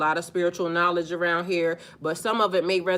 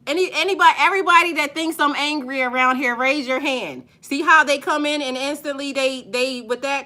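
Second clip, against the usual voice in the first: higher and louder, rallying the chat; raised calling out; 7 semitones higher, 5 dB louder than usual. Real, not acted. Streamer calling out viewers who think she's angry, loud and high. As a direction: call out to everyone watching, loud and fired up.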